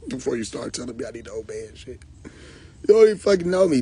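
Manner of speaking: ordinary delivery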